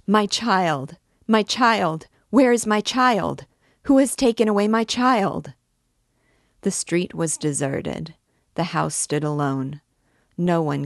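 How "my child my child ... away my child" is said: put-on high, loud and frantic, calling for her lost child; a mother's desperate wail, voice rising louder each time; anguished crying out. A narrator's reading.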